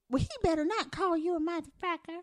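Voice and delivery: imitating the lady. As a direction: quote the grandma in a put-on voice. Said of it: "the lady in the background say".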